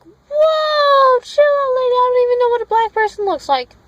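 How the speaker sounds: high, loud mock protest; hammed-up high yelp of protest; mock outburst. Acted protest to grandmother's warning.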